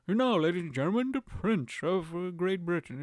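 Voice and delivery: plummy royal proclamation; posh royal announcer. Retold or imitated. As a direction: announce it like a stuffy royal herald. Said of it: "Or just like the".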